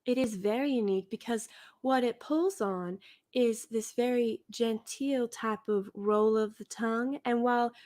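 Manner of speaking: slow, genteel Southern roll of the tongue; Deep South cotillion accent. Shown as a demonstration. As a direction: demonstrate a lulling, unhurried cotillion Southern accent. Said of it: "let me see if I can".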